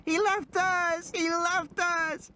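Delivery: put-on high, slow, wailing child's cry; screaming child. Lex wails that Ed Regis abandoned them, screaming child. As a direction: wail it out like a terrified girl. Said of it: "she had started to scream".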